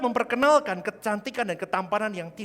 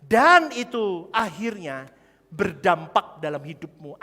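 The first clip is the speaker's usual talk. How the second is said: loud and slow, each phrase leaned on; building sermon climax; slow emphatic preaching; 5 semitones higher, 7 dB louder than usual. Real, not acted. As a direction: drive the point home slowly.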